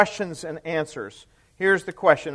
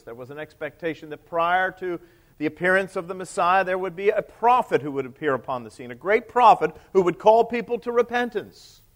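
normal talking voice

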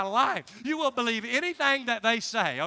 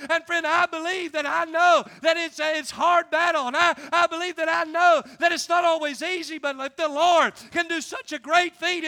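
loud, raised, driving sermon delivery; full-force preaching; 3 semitones higher, 5 dB louder than usual. Real, not acted. Preacher proclaiming salvation to the church, impassioned. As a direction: proclaim it fervently to the congregation.

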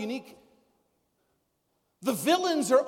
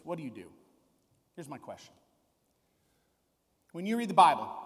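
normal talking voice

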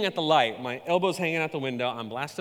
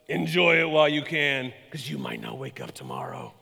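ominous stranger warning. Retold or imitated. Stranger at a wall warns her at the light, ominous. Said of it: "this is the way he said it".

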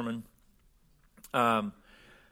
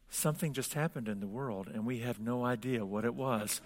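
hushed, nervous retelling; mock nervousness; 2 semitones lower, 10 dB quieter than usual. Girl in the commercial after the internet goes out, hushed mock nervousness. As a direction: imitate nervous disbelief, much softer. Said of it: "says sort of nervously".

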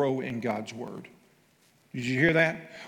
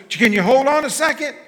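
high, loud brush-off; distracted stalling; 10 semitones higher, 9 dB louder than usual. Husband imitating his own brush-off to his wife. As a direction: put her off while busy.

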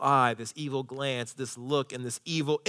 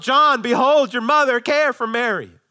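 high, loud calling out; preached yell; 10 semitones higher, 9 dB louder than usual. Retold or imitated. Preacher voicing Jesus calling to John, preached yell. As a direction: shout the instruction as a preacher re-enacting it. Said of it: "he really yelled".

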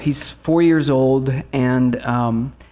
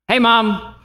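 put-on little boy calling; 8 semitones higher, 4 dB louder than usual. Son calling from bedroom.

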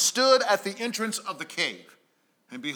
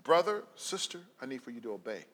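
hushed voice of God; 8 semitones lower, 8 dB quieter than usual. Acted. God's call voiced in sermon.

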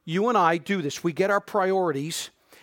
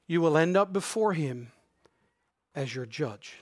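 hushed, weighty delivery with long pauses; slow right down and let each phrase land; slow and purposeful; 2 semitones lower, 4 dB quieter than usual. Real, not acted. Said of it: "more slowly and more purposefully".

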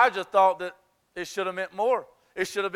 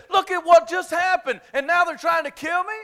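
higher, louder indignant shout; mock outrage; 10 semitones higher, 7 dB louder than usual. Elijah protesting to God, acted high and loud. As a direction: shout it like a wronged man.

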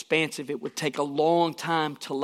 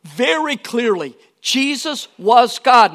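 emphatic declaration; 6 semitones higher, 9 dB louder, slower than usual. Real, not acted. Preacher declaring Jesus' divinity.

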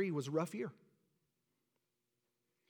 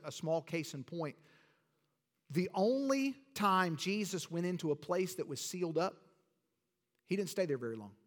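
ordinary talk, nothing marked

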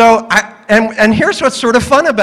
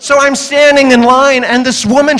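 ordinary delivery

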